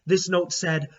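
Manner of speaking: usual speaking voice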